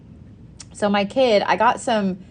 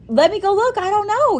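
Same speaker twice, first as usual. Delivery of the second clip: flustered; 3 dB louder than usual. Retold or imitated. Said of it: "I was like".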